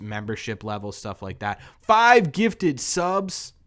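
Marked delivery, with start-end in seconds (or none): 1.8-3.5 slow, loud-pitched hype on a gift; real excited shout-out, drawn out and higher; excited announcement. Real, not acted. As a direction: stretch each word out high in amazement. Streamer announces a viewer's surprise gift.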